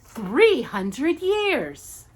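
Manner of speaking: slow, loud eager witch. A narrator's reading. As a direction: announce it gleefully. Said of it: "she said eagerly".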